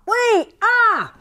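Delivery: high, loud call-and-response shout. Shown as a demonstration. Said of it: "say it really loud".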